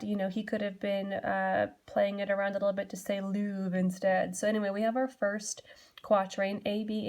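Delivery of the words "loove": Louvre said with a long oo, for love; old-style rhyme pronunciation. Shown as a demonstration. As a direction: stretch the vowel of Louvre into a long oo. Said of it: "we would say that love".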